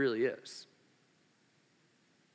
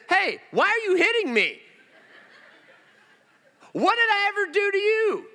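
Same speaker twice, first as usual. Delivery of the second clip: high, loud wounded protest; hammed-up outraged donkey, pitched way up; indignant talking donkey; 6 dB louder than usual. Preacher as Balaam's beaten donkey turning round, indignant protest.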